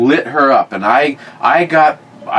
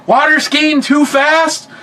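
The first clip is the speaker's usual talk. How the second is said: very high, drawn-out disbelieving question; incredulous grandfather; slower than usual. Retold or imitated. Grandfather repeating the aunt's complaint, mock incredulous. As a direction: echo the accusation as if it's ridiculous, slow and high.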